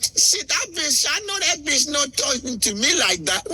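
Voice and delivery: high, mocking African woman's voice; hammed-up, high-pitched African diva; African reality-show woman. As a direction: imitate an African reality star talking American slang. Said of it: "And she's like".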